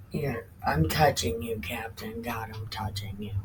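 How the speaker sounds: calm the frightened dog gently; quiet reassuring murmur to Captain; soothing the dog. Real, not acted.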